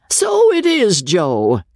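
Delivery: crying out. Acted. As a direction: call out proudly to the ostler. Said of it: "cried the baronet".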